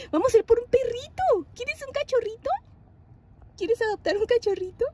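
telling her daughter about a puppy in a high sing-song. Real, not acted. Talking to toddler about puppy.

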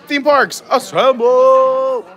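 high, drawn-out rallying cry; excited catchphrase shout. Acted. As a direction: belt it like a team battle call. Stream catchphrase called out to a fellow streamer.

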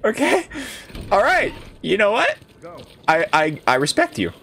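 high-pitched, stammering show of respect; amused, flustered concession. Real, not acted. Flustered respect after laughing fit.

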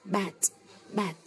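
Bat said with a short flat a. Shown as a demonstration. Teacher reading Bat aloud to learners. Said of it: "Mặt chữ phiên âm".

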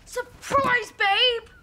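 high, excited yell; practice shout. Shown as a demonstration. Child rehearsing the surprise greeting, shouting. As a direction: burst out with it at full pitch. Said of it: "you shout".

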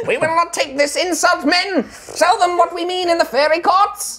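outraged tiny fairy, loud and very high; high-pitched warrior captain. Acted. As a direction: squeak out a rousing battle cry. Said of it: "kind of high-pitched voice".

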